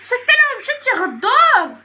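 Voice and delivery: high, loud, slow accusing cry; mock betrayal. Real, not acted. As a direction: call out the traitor, dragging it. Teammate left him behind. (عتاب ساخر بصوت عالي)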